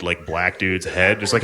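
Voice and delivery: ordinary delivery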